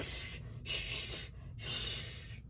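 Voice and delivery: hushed, breathy shushing of a know-it-all; a room full of whispered shh. Retold or imitated. Crowd shushing Hooper.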